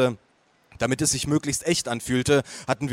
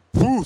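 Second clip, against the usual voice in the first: higher, louder exasperated puff; puffed sigh; 10 semitones higher, 5 dB louder than usual. A narrator's reading. Grandpa checking his watch, impatient puffed sigh. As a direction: blow out an impatient puh.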